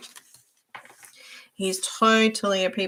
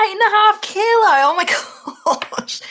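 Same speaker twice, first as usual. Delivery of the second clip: shocked exclamation; 12 semitones higher, 8 dB louder than usual. Real, not acted. Shocked by dog's weight.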